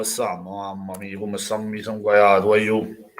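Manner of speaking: low, soft, defeated groan; despairing moan. Real, not acted. Fan moaning to viewers that they are losing two-nil, despairing. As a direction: sink into it like all hope is gone.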